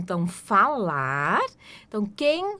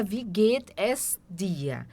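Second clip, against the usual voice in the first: "Wie geht es dir?" slowly, every word sounded; careful full form "geht es", slow; 2 semitones lower, much slower than usual. Shown as a demonstration. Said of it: "escrevendo tudo certinho".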